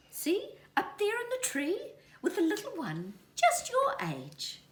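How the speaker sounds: very high, laughing mother voice; cheerful motherly pointing out; mother kiwi. A narrator's reading. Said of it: "assured Mother Kiwi".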